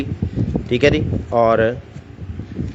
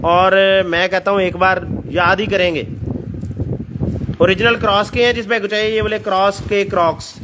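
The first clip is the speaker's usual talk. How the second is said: ऊँची, जोशीली sales pitch; overeager teleshopping pitch; 4 semitones higher than usual. Acted. Raised, excited sales pitch. (बढ़ा-चढ़ाकर बेचने वाली आवाज़)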